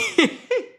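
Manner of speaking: high burst of laughter; a sudden squealing laugh; high cackle of amusement. Real, not acted. Laughs at viewer comment.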